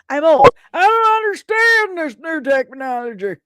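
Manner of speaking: put-on high, loud creaky old-timer voice; mock old person. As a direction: play the confused old-timer for a laugh. Jokes about being old.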